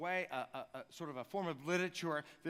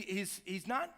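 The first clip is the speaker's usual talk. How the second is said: ordinary talk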